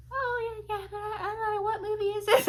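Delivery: very high, put-on prattling voice; chatterbox imitation. Talkative woman answering movie watchers, high mocking imitation. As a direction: mimic her oblivious reply in a high drone. Mimicking the chatterbox's oblivious reply.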